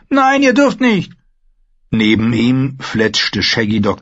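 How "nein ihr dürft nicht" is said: put-on high, loud child's protest; angry little boy. Rickon protests to the dying maester, high and loud. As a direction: stamp it out like a furious small child. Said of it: "widersprach Rickon zornig".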